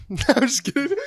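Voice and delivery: laughing through the words; real laugh, teasing. Real, not acted. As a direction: crack up as you say it. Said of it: "and I'm laughing".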